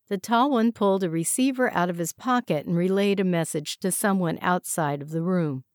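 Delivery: plain reading voice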